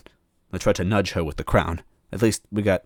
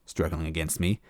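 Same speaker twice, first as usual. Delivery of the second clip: plain reading voice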